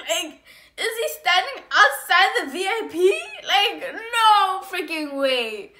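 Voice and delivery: high, loud disbelief; incredulous. Acted. Spotting her ex outside the V I P, incredulous. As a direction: raise the voice in outraged disbelief.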